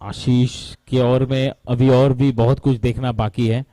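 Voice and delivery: ordinary talk, nothing marked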